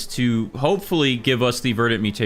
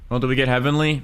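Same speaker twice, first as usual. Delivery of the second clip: ordinary talk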